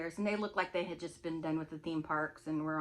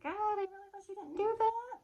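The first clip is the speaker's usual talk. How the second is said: high, thin-volume lament; retold dismay; 13 semitones higher than usual. To friend who gave out the room number, dismayed. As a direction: plead in a much higher voice.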